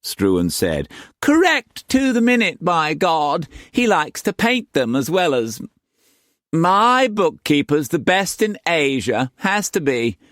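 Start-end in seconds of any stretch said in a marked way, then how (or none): 1.2-10.2 high, haughty and insistent; madam voice. A narrator's reading.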